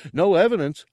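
high, loud character declaration; high-voiced, emphatic "no evidence"; proud simpleton. Gartog's proud proof, then Ortug's reply.

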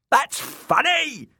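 high, drawn-out jester's crow; slow, shrieky high fool voice. A narrator's reading. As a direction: crow with cruel glee. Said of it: "Shagwell crowed as Dick fell".

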